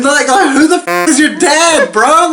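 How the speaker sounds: high, loud, exasperated demand; exasperated yell. Real, not acted. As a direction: shout the question in disbelief. Exasperated question to audience.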